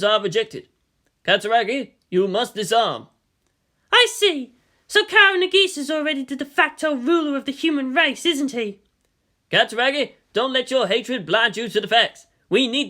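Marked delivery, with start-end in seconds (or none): 3.9-8.8 higher, grown woman voice; measured commander voice. A narrator's reading. Misato voiced in story.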